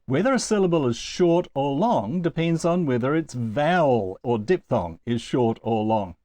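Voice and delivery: normal talking voice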